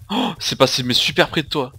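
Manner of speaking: ordinary delivery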